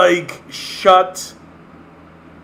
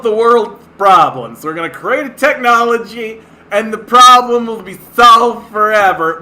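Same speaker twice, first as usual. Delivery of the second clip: louder, higher mock-grand promises; mocking tech optimists; 5 semitones higher, 7 dB louder than usual. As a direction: ham up the smug tech pitch.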